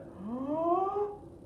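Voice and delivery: huge, high, drawn-in gasp; big inhale. Acted. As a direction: suck in air like a dragon. Dragon inhales deeply.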